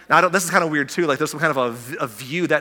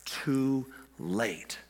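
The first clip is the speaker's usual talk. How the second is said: "too late" drawn out, much softer; hushed; 3 semitones lower, 10 dB quieter than usual. Acted. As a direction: let "too late" sink away quietly. Sermon point, dropped low.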